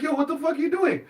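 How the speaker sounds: high-pitched startled protest; incredulous, voice jumping way up; mock alarm. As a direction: act out panicked disbelief. Alarmed protest to girl in story.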